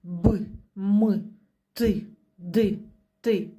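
б, м, т, д tapped out like little hammer blows; hard, unsoftened consonant sounds б, м, т, д. Shown as a demonstration. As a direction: say б, м, т, д hard, each a separate short beat. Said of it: "як молоточком стучимо".

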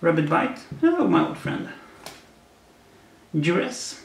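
ordinary talk, nothing marked

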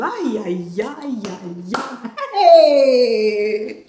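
rising ay-ay-ay into a loud, high whoop; joyful cry. Real, not acted. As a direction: let the joy burst out. Celebrating the money arriving.

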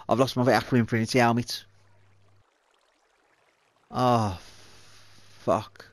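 ordinary talk, nothing marked

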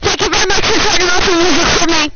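loud, fast shouted thanks; rapid-fire loud delivery; screaming. Real, not acted. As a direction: yell it quickly into the mic. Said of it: "you should stop screaming".

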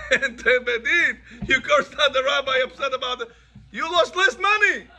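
laughing. Real, not acted. Laughing at the irony.

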